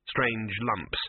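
plain reading voice

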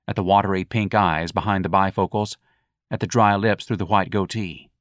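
usual speaking voice

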